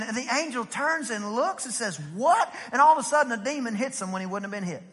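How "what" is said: surprised what. Retold or imitated. Angel turns surprised.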